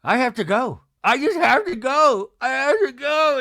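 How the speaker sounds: high, loud, hammed-up wail; imitated melodramatic meltdown; mock crying. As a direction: wail it as a tearful protest. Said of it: "she's, like, crying".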